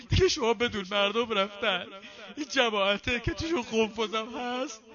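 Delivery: high, loud preaching at full force; impassioned preaching. Acted. Preacher denouncing the oppressors to the mourners, impassioned high loud preaching. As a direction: cry it out to the congregation.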